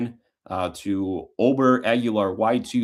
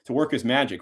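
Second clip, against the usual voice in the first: live play-by-play call; 2 semitones higher than usual. Real, not acted. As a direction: ring out "straight down the middle and true". Calls the extra point live.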